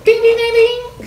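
very high sung ding; bell imitation. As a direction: chime like a notification bell.

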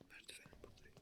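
hushed whisper while hiding. Real, not acted. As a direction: whisper so it can't hear.

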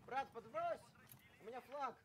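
cover me shout. Real, not acted. Carrying flag, calling for ride.

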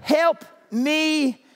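very slow, loud and high; drawn-out plea. Acted. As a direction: stretch every word out. Drawn-out playful plea to crowd.

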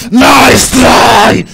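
loud mocking cheer; taunting shout. Real, not acted. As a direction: yell it at the beaten enemy.

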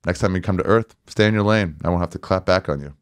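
ordinary talk, nothing marked

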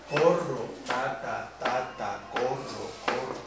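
"corro" and "ta, ta" as even beats, first syllable stressed; rhythmic demo. Shown as a demonstration. Teacher showing students the march cell with a spoken word, rhythmic demo. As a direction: tap out "corro, ta, ta" in low, measured pairs. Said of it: "cuyo acento esté en la penúltima sílaba".

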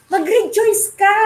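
high, slow, drawn-out order to rejoice; a mock preacher's command; emphatic command. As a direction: command them to rejoice, stretching it. Acting out the imperative command.

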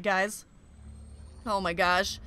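ordinary delivery

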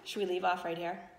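low, soft playful question; teasing aside. Real, not acted. Reader teases the children about a cliffhanger, softly. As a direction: tease the listeners about stopping. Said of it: "narrator teases cliffhanger".

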